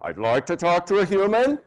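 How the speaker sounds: rising, irritated voice to get a human; angry demo. Shown as a demonstration. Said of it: "rising tones".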